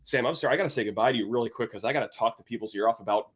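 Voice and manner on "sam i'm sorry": ordinary talk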